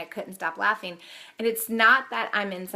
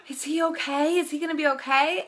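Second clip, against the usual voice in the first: higher, anxious imitation of a worried stranger; worried mom; 7 semitones higher than usual. Stranger in car asks about fallen boy, imitated higher and anxious. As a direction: mimic a concerned mom calling out. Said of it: "and she's like".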